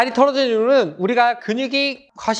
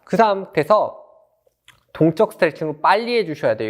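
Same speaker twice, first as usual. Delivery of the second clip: normal talking voice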